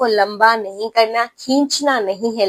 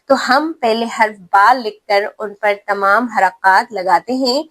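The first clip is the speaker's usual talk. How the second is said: usual speaking voice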